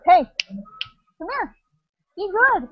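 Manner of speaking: high, slow call to the dogs. Real, not acted. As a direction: coax the dogs over gently. Calling to the dogs.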